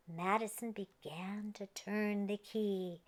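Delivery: ordinary delivery, nothing marked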